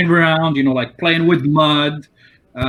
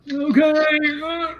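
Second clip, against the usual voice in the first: put-on young kid. Kid answers, voiced high.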